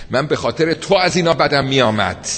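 building to a high loud cry; shouting plea. Retold or imitated. Condemned man crying out to Ali, rising shout. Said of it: "داد زد".

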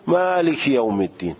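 مالک یوم الدین in an Arabic accent; Arabic-accented Quranic مالک یوم الدین. Shown as a demonstration. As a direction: recite مالک یوم الدین with Arabic vowels, maaliki yawm. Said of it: "عربی باید بگی".

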